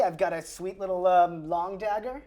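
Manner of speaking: ordinary delivery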